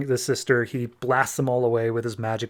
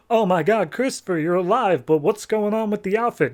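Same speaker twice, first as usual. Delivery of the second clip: higher, excited disbelief; a mock gush of shocked joy; amazed relief; 7 semitones higher than usual. Family seeing their missing son alive, higher amazed relief.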